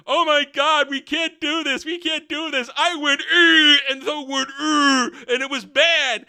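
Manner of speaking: high, loud mock wailing wife; hammed-up complaining. Disa complaining to Durin, hammed-up high wailing. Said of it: "who starts complaining".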